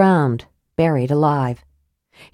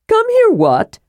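very high, eager kender squeal; excited cry; 4 dB louder than usual. A narrator's reading. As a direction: pipe up high, bursting with curiosity. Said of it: "cried Tasselhoff".

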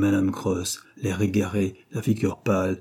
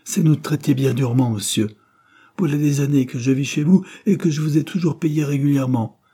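heated protest, higher and louder; rising anger; 5 semitones higher, 5 dB louder than usual. A narrator's reading. Tenant protesting to his landlord, rising anger. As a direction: protest with anger starting to flare. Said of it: "Jacob sentait sa colère s'enflammer".

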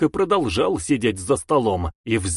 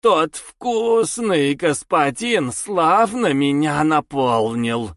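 slow, eerie sated demon voice; creeping nightmare whisper; 3 semitones higher, slower than usual. Acted. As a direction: savour each word like a monster. Half-demon speaks after feeding.

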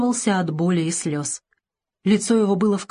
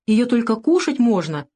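put-on higher, slow little-boy voice; sensible, reasoning; 5 semitones higher, 4 dB louder than usual. A narrator's reading. Savushka corrects the doctor about the pill, reasonably. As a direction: explain it carefully as a wise toddler. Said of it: "рассудительно заявил Савушка".

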